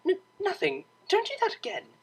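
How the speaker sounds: flustered squeak. Acted. Cellist denying she is ticklish, high flustered squeak. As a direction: squeak it out, embarrassed.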